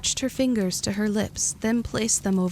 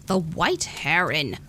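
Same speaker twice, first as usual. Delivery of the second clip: slow, low grumble; grumbling; 3 semitones lower, slower than usual. A narrator's reading. Lily names the bed and breakfast, slow low grumble. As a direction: mutter the name grudgingly. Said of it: "Lily grumbled".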